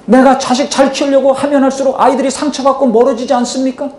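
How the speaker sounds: ordinary delivery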